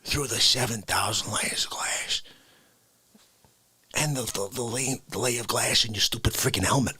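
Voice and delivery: soft low whisper; hushed. Acted. Telling cohost the Pluto bit in a hushed whisper. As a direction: hush it like a secret.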